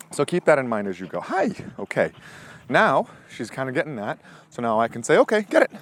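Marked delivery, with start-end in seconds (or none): 5.2-5.7 higher, louder go-for-it call; excited release cue. Real, not acted. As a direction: fire her up to grab. Releasing dog to grab.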